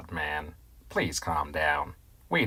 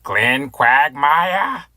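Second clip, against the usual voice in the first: stretched-out indignant yell, much higher and louder; outraged quote; 9 semitones higher, 9 dB louder, much slower than usual. Retold or imitated. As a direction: bellow the name in disbelief. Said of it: "he was like".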